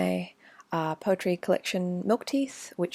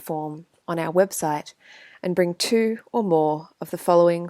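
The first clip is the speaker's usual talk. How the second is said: emphatic, mock-bureaucratic poem reading; sarcastic official letter; 5 dB louder than usual. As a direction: read out a rejection notice with pointed, punched stresses.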